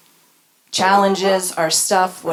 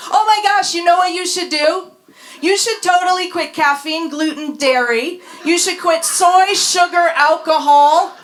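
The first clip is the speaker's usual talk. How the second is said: much higher, louder, bubbly know-it-all; hammed-up excited health nut, laughing at the end; gushing wellness friend; 8 semitones higher, 5 dB louder than usual. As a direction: gush the diet tips like a revelation. Mocking gushing health advice.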